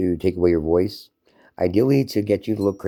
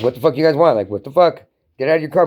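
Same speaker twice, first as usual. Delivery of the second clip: retold shouting; 7 semitones higher, 5 dB louder than usual. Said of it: "I'm like".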